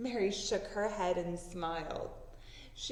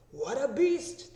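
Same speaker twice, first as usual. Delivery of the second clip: loud, slow, astonished man's voice; amazed men crying out; 4 semitones higher, 6 dB louder, slower than usual. Acted. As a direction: marvel out loud at the beast. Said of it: "they cried".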